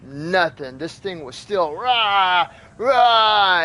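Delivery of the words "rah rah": drawn-out snarling "rah"; imitated animal snarl. Telling how the trapped animal kept fighting, imitated snarl. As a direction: mimic a trapped animal's cry, rising.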